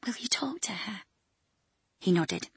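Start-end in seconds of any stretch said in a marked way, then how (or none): 0.0-1.0 whisper. Acted. Hushed bedside request.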